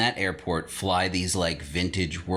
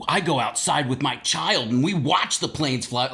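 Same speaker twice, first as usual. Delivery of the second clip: higher, louder prim neighbor voice; mock smug parent; 7 semitones higher, 3 dB louder than usual. Neighbor defending the planes, smug mock. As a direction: play the self-righteous parent.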